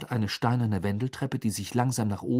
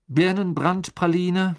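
higher, louder, drawn-out guess; password guess; 9 semitones higher, 7 dB louder, slower than usual. A narrator's reading. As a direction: call out a guess to test it.

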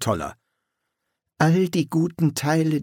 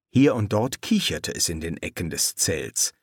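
plain reading voice

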